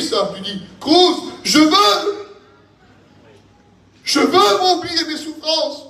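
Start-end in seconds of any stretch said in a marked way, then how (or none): 0.8-2.3 high, long-drawn preacher's cry. Acted. Believer declaring resolve in the sermon, crying out. 4.0-5.8 high, drawn-out impassioned cry. Acted. Believer wanting to forget his sufferings, crying out. Repeats Job's verse as a cry.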